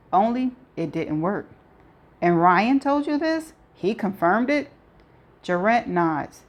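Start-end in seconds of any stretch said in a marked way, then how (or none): 2.2-4.7 higher, mildly disbelieving questions; a touch of disbelief, voice lifting; incredulous questioning. A narrator's reading. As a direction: ask it as if you can hardly believe it. Hannah questions shocking news.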